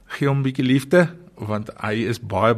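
normal talking voice

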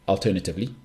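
ordinary delivery

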